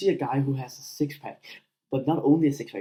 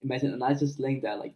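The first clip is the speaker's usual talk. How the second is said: ordinary delivery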